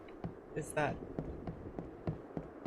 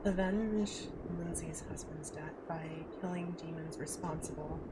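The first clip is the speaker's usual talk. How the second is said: whispering; 8 dB quieter than usual. Real, not acted. Reads quest text quietly.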